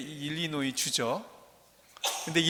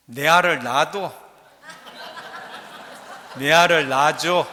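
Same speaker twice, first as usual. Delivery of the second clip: loud, forceful Gyeongsang dialect; loud Gyeongsang dialect, full of bravado; 9 dB louder than usual. Shown as a demonstration. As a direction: bold Gyeongsang dialect, breaking into a laugh at the end. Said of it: "경상도 사투리는 뭐라고 할까요?".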